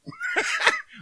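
a short squeal of laughter; high laugh. Real, not acted. Preacher laughs about people disliking his ministry, high laugh. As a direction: laugh off the critics.

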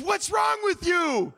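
loud, high, slow retort; baffled yell. Retold or imitated. Tradesman reacting to shouted hallelujahs, baffled yell. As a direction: yell it in disbelief at his shouting.

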